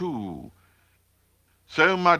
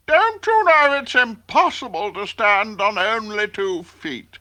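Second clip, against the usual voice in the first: grouchy ladybug; 9 semitones higher, 7 dB louder than usual. A narrator's reading. Ladybug scolds Peep.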